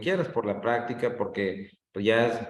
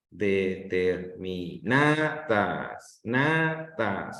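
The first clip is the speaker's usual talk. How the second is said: "Determinadas" muy lento, silabeado, abriendo y alargando NA; acento en NA; 2 semitones lower, much slower than usual. Shown as a demonstration. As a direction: abre más la boca en el NA de "Determinadas", luego repite "Natas". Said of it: "la sílaba que se escucha mejor es en na". ("Determinadas" con el golpe de voz en NA)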